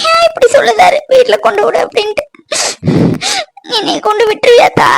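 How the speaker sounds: high, tearful pleading through sobs; sobbing. Acted. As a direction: cry it out, begging not to be sent away. Girl sobbing, fearing being sent home. (அழுகையுடன் கெஞ்சும் குரல்)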